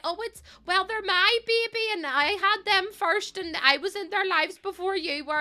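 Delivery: high mocking voice of a clingy mum; mocking possessive mum. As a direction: send up the mums who claim their sons. Mocking possessive boy mums.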